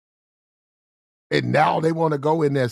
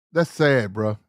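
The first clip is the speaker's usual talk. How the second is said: usual speaking voice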